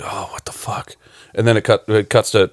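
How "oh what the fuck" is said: whispered quote. Retold or imitated. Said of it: "he shoots up out of bed".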